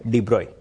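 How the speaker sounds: de Broglie as "dee broy", silent g; correct pronunciation. Shown as a demonstration. Teacher telling students how to say it, de Broglie as "dee broy". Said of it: "you will also pronounce it as".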